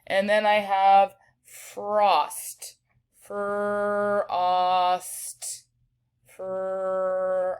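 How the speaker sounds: frost said slowly, sound by sound; teacher stretching frost for spelling. Shown as a demonstration. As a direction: draw frost out to hear its sounds. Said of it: "five sounds in frost".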